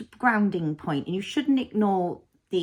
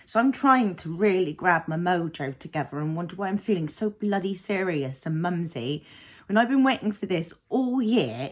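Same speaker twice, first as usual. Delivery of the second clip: ordinary talk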